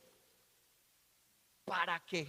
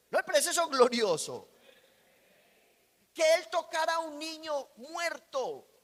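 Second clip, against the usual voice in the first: higher, louder preaching voice; fervent preaching; 5 semitones higher, 5 dB louder than usual. Acted. Preacher stirring the congregation about Jesus' miracles, fervent preaching. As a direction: preach it with rising fire.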